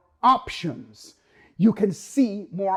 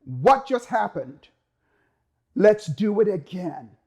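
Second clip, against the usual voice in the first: the brain's pleased voice; 3 dB louder, slower than usual. Retold or imitated. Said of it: "the brain goes".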